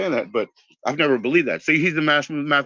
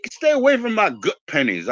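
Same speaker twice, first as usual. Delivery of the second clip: put-on high, scolding old lady warning off a customer; lady with the cart; 10 semitones higher than usual. The cart lady guarding her coins, high scolding voice. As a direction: shoo people from her pennies in a high scold. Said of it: "from the lady with the cart".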